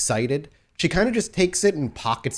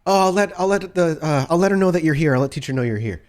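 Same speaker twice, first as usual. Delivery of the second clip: put-on higher, hurried girl's voice, relieved; relieved young girl; 6 semitones higher, 4 dB louder than usual. Young girl hearing it is only Max, relieved and high. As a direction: let out relief, then stammer helpfully. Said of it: "you hear a sigh of relief".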